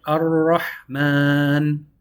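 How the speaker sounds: Ar-Rahman drawn out very slowly, R rolled. Shown as a demonstration. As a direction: stretch Ar-Rahman, trill the R. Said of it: "roll our R's a little bit".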